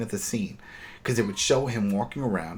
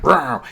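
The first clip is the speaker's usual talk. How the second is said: walker growl; 6 semitones higher, 9 dB louder than usual. Retold or imitated. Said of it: "Just went".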